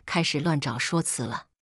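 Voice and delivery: plain reading voice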